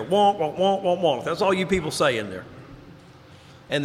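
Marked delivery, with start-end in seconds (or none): 0.0-1.2 mock muted-trumpet wonk wonk; Charlie Brown adults. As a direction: imitate the droning teacher voice from Charlie Brown. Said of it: "Charlie Brown's people are there".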